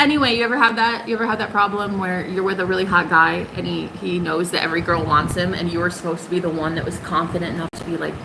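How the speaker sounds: ordinary talk, nothing marked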